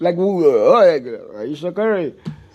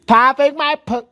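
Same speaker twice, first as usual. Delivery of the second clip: mock wife quote; 7 semitones higher, 3 dB louder than usual. Podcaster mocks the wife's old brag in a high voice. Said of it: "that's your wife".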